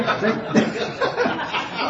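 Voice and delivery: real, high breathy laughter; helpless laugh. Real, not acted. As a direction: laugh it out, wordless. Still tickled by the story.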